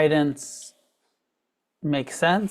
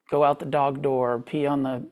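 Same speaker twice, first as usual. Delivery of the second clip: ordinary talk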